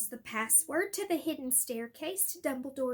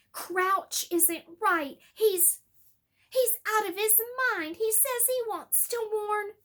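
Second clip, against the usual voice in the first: loud, higher angry insistence; 7 semitones higher, 6 dB louder than usual. Acted. Harry to Snape, loud and angry. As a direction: force every word. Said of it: "said Harry angrily".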